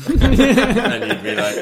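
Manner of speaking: high, loud laughing through the words. Real, not acted. Comic laughing at his own train routine. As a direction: crack up while telling the bit.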